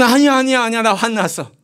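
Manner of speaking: high, insistent acted denial; mock protesting denial. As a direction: play the husband protesting over and over, pitched high. 남편이 아내에게 화 안 났다고 부인. (높은 목소리로 부인하는 남편 흉내)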